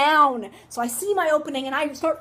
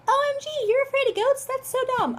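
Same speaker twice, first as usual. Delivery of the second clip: high, quick mocking voice; hammed-up high-pitched ridicule; mocking imitation; 11 semitones higher, faster than usual. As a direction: imitate a scoffing viewer, pitched way up. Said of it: "if any of you are like".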